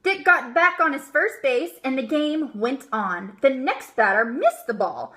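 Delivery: plain reading voice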